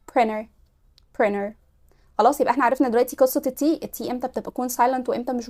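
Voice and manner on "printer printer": "Printer" as "prinner"; no T after the N in "Printer"; dropped T. Shown as a demonstration. Teacher giving the American answer for "Printer". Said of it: "ولا printer".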